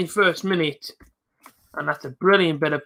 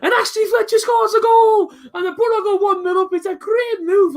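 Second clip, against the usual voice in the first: high, fast commentator-style cry; excited goal call; 13 semitones higher than usual. Real, not acted.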